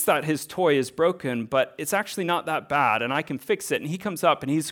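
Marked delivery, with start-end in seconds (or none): none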